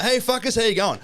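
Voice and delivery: big brash mock greeting, pitched well up; Stifler greeting. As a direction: burst in like a party-boy Stifler. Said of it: "I walk in like Stifler".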